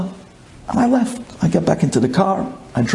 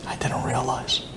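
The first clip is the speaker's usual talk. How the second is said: whisper; 5 semitones lower, 9 dB quieter than usual. Real, not acted. Hushed aside in story.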